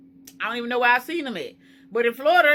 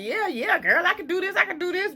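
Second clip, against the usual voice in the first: high pep talk to herself; self pep talk; 8 semitones higher than usual. Retold or imitated. Quoting her own pep talk, high and eager. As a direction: psych herself up. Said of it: "I'm like".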